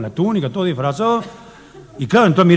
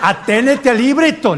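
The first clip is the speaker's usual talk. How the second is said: higher, slow mock yell from the chair; mock shout; 9 semitones higher, slower than usual. Director yelling at the kids from his chair, mock shout. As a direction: call out like a fussy director. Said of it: "le grita".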